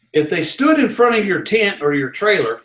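ordinary talk, nothing marked

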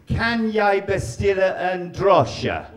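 slow, deliberate "Kan jeg bestille en drosje?"; careful Norwegian attempt. Shown as a demonstration. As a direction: sound out the Norwegian like a proud learner. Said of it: "the other one I learned was this".

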